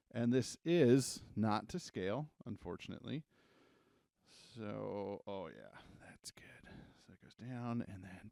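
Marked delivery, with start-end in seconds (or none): 4.6-8.3 whispered aside to self. Real, not acted. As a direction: breathe it under your breath. Muttering while drawing.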